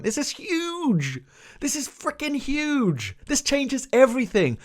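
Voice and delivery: high, stretched-out emphasis on how big it is; incredulous fan rant, high-pitched; exasperated excitement. Real, not acted. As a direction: pitch it way up and draw it out. Indignant rant about skipped plot twist.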